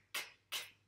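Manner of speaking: "k" as a bare voiceless click; isolated k sound. Shown as a demonstration. Teacher isolating the sound for a pupil, bare "k". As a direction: say "k" alone, no vowel after it. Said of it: "le son k".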